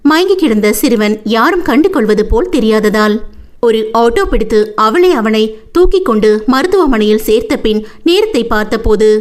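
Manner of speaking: ordinary delivery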